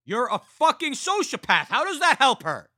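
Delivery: very high, loud furious yell; furious scream. A narrator's reading. Roger screaming at his killer brother, furious. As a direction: scream it at him in rage.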